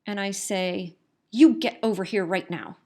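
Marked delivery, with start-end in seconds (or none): 1.3-2.8 loud, firm order with weight on YOU; emphatic parental command. Retold or imitated. Parent finds her lost four-year-old in the store, loud panicked command. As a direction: punch "You" hard, a scared parent taking charge. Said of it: "after twenty minutes of panic".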